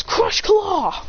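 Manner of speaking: high-pitched attack cry; battle command. Acted. Trainer ordering Lycanroc's claw attack, high battle cry. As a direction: call the move out high.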